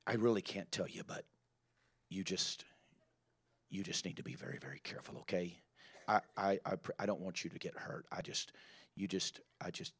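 low, hushed, confiding gossip; hushed secretive warning. Retold or imitated. Gossip warning a newcomer about a brother, hushed and secretive. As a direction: lean in and drop to a conspiratorial hush.

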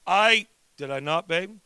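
usual speaking voice

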